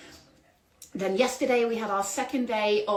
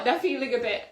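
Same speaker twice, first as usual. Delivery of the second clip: normal talking voice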